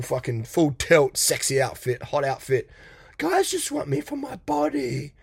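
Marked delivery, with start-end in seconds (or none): 3.2-5.1 a hint of a whiny model, higher; mock model. Mocking a model complaining about guys, lightly pitched up. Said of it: "it's like a Victoria's Secret model".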